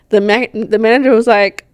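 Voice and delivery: usual speaking voice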